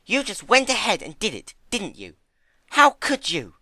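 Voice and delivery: loud, high hurt outrage; loud high hurt anger; upset accusing. Acted. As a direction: confront a friend's betrayal. Fluttershy upset.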